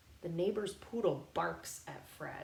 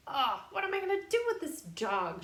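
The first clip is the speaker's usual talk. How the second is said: exasperated mom; 7 semitones higher, 5 dB louder than usual. Acted. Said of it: "she says".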